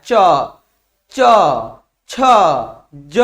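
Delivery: "च, च, छ, ज," each said alone, tongue meeting the palate; demonstrated palatal sounds. Shown as a demonstration. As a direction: say "च, च, छ, ज," one by one to show the palate contact. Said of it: "जब बोल के देखो". ("च, च, छ, ज," तालु से, अलग-अलग बोलकर दिखाते हुए)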